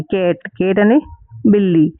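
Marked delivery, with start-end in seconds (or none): 0.0-0.5 कैट with the flat ae, not the short uh of कट; correct vowel demo. Shown as a demonstration. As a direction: say कैट softly with a clear ae. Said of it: "कट नहीं पढ़ेंगे".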